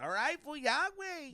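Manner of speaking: annoyed woman voice. Retold or imitated. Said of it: "she was just like".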